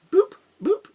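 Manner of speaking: very high, loud boop boop; boop sound effect. Shown as a demonstration. Explaining the first dimension with a high boop effect. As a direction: chirp two dots into place. Connecting the dots.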